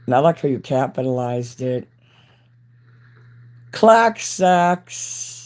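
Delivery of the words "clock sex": loud catchphrase. Acted. Shouts her catchphrase.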